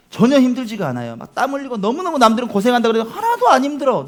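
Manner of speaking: ordinary talk, nothing marked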